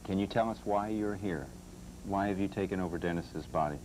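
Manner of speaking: ordinary talk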